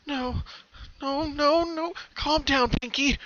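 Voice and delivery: pony tries to calm her own panic, pleading; calming herself. A narrator's reading. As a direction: talk yourself down from panic.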